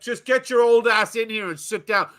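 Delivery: high-pitched mock scolding; imitating rude waitress. Waitress ordering grandma to her chair, acted insolence. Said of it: "just arrogant and rude to you".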